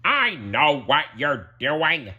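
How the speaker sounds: drawn-out, exasperated delivery; Squidward, interrupting. Acted. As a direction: cut them off with weary annoyance.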